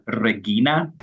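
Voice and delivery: Regina with a hard g and "ee", re-GHEE-na; classical Latin pronunciation. Shown as a demonstration. Expert explains how classical Latin said it, Regina as re-GHEE-na. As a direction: say Regina the old Latin way, reh-GHEE-nah. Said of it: "was originally pronounced as".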